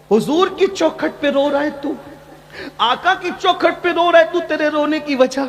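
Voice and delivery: high-pitched, sustained, impassioned question; acted astonished questioning. Umar asks the weeping man at the Prophet's door, high impassioned questioning. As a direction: ask it high and insistent, voice held up.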